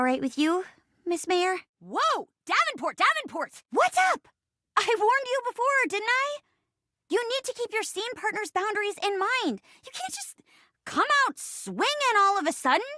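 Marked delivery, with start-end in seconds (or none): 3.7-4.3 high-pitched, punchy hello; exuberant greeting. Acted. Bursting greeting in acted scene. 4.8-9.6 high-pitched, exasperated telling-off; indignant scolding. Acted. Acted scolding of scene partner.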